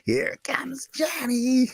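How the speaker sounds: high, drawn-out strained mock scream; imitated scream. Kid screaming into the mic while playing Fortnite, imitated high scream. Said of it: "He screams into the mic".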